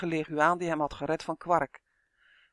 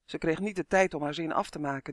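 ordinary delivery

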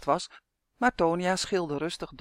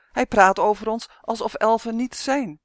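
loud, higher indignant elf; grumbling complaint; 5 semitones higher, 6 dB louder than usual. A narrator's reading. The elf accuses Justin of denying elves, loud grumbling complaint. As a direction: complain loudly, offended. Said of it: "mopperde de elf".

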